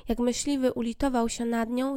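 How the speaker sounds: ordinary delivery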